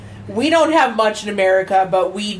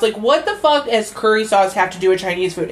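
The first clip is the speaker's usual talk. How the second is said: normal talking voice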